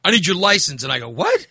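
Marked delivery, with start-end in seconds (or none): 1.1-1.5 high, rising startled question, retold; incredulous what. Driver answering cop's speeding claim, retold incredulous yelp. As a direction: squeak out a retold disbelieving "what".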